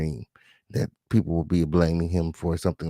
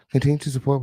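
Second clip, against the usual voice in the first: normal talking voice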